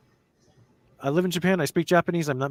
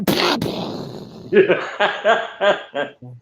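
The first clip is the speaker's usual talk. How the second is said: breathy laugh; 9 semitones higher, 5 dB louder than usual. Real, not acted. Laughs after impression.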